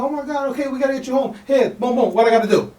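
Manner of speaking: high, mildly alarmed lady; lightly voiced worried woman; shocked neighbor. Neighbor shocked by his story, mildly high and alarmed. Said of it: "She was like".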